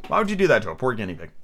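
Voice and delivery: normal talking voice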